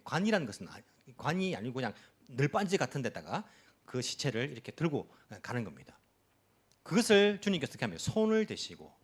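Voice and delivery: ordinary talk, nothing marked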